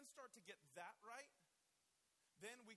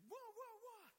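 put-on much higher, playful little-boy whoas; mock fight cry; 13 semitones higher, 3 dB louder, slower than usual. Boys coming out swinging in the living room, playful high whoas. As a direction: yelp like a kid charging into a fight. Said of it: "we would just".

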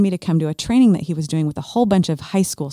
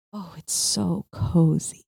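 tender; much slower than usual. Retold or imitated. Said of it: "and just rocking back and forth and going".

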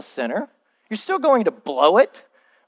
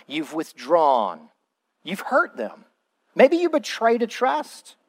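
ordinary delivery

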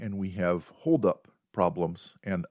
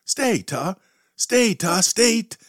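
high, louder and louder commands; yelling stay; 13 semitones higher, 5 dB louder, slower than usual. Retold or imitated. Owner yelling at the advancing dog, building high yell. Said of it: "And I yell".

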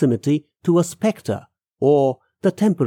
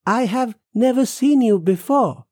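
higher-pitched, puzzled statement; puzzled man; 8 semitones higher than usual. Acted. Man to an unknown woman at night, puzzled.

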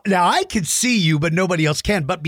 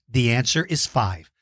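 ordinary talk

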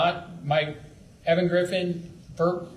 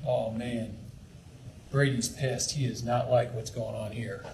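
students' murmur; 7 semitones lower, 8 dB quieter than usual. Retold or imitated. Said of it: "your students are gonna read it".

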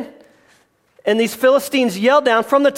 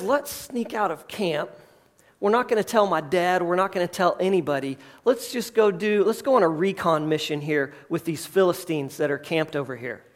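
softer, lower, hushed plotting; conspiratorial hush; 4 semitones lower, 7 dB quieter than usual. Acted. Jonathan to his armor bearer, planning a secret raid, hushed. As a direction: keep it down like sneaking out of camp.

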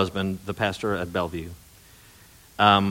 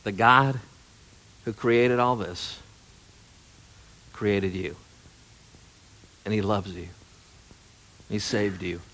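tender reassurance. Retold or imitated. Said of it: "And she said".

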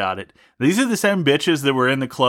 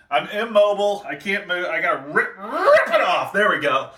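mock strain; 6 semitones higher than usual. Wrestling the snake off.

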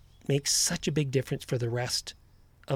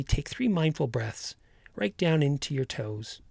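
calm grounding exercise. Acted. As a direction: guide them gently into the body.

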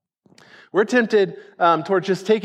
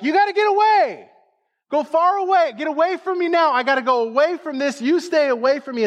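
urgent, high-pitched rebuke; emphatic yelling; 8 semitones higher than usual. Acted. Preacher paraphrasing Jesus ordering Satan away, emphatic high yelling. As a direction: drive them off in a raised, high voice. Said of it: "like he yelled at him".

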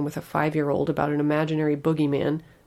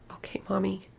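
put-on small teary child voice; sniffling; 4 semitones higher, 5 dB quieter than usual. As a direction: sniffle it out, tearful. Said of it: "she sniffled".

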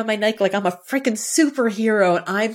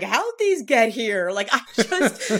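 dazed confusion; 3 semitones higher, slower than usual. Retold or imitated. Heat-dazed runner finding ice.

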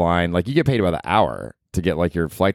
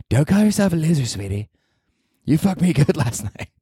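high, put-on girlfriend voice; South Park kid voice playing a doting girlfriend; pretend girlfriend; 7 semitones higher than usual. Imagined girlfriend comforting her boyfriend, high cartoonish voice. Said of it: "kid on South Park when I use that voice".

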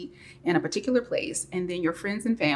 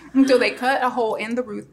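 usual speaking voice

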